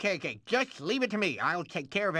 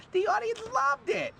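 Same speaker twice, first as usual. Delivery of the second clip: high-pitched showbiz gushing; upbeat reassurance; 13 semitones higher than usual. Acted. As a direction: gush to lift their spirits. Producer cheers up the teacher.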